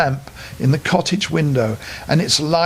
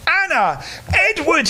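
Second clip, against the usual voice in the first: high joyful calling; joyful cry; 12 semitones higher than usual. A narrator's reading. Father greeting his lost children, high and joyful. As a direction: call out in delight. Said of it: "he cried".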